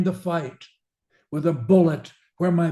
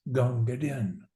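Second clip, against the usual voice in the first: soft, falling close on the name; hushed tribute; 2 semitones lower, 5 dB quieter than usual. Acted. Soldier's final quiet farewell to Din, hushed. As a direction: let the name sink away quietly. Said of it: "And finally says".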